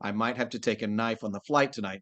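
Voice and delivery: ordinary talk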